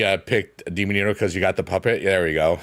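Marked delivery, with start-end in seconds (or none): none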